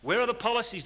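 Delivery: pointed public question; loud and clear. Retold or imitated. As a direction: call it out to the crowd. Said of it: "he said loud and clear".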